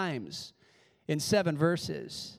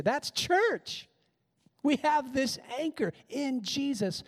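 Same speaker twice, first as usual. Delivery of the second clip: excited preaching; 9 semitones higher than usual. Real, not acted. Pastor rising to a climax.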